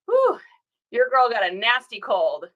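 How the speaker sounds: ordinary talk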